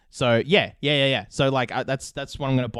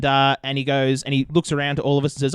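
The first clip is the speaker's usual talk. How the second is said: ordinary talk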